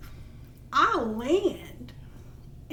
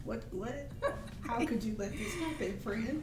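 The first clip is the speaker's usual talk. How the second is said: forced calm; 10 dB quieter than usual. Retold or imitated. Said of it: "I'm trying to be all calm".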